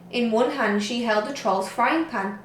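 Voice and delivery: ordinary delivery, nothing marked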